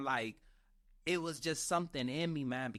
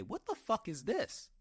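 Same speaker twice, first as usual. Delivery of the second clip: shocked outburst; 6 semitones higher than usual. Retold or imitated. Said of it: "everybody in that office heard me say".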